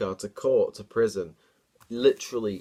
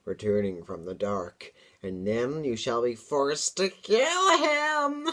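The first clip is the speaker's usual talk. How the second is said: hissing demon; slower than usual. Acted. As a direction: threaten from the dark like a demon. Demon voice hisses from above.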